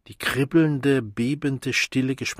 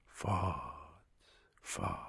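low word fading into a whisper; ever softer, deeper; 7 semitones lower, 15 dB quieter than usual. A narrator's reading. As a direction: sink lower until only breath is left. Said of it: "Immer leiser, immer tiefer".

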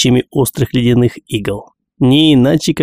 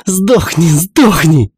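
high, loud furious cry; loud shout; 6 semitones higher, slower than usual. Acted. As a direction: yell it with every blow. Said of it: "сопровождался громким криком". (громкий яростный крик)

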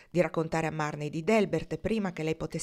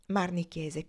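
ordinary delivery, nothing marked

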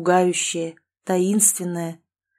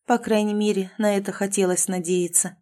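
usual speaking voice

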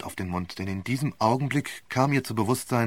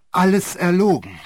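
higher, louder furious denial; raging; 7 semitones higher, 8 dB louder than usual. Acted. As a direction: spit it out in a rage. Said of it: "tobte die Zeugin".

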